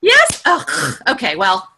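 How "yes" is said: high, louder startled question; voice going up. Real, not acted. Trainer reacting to someone who already knew, voice going up. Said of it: "My voice goes up".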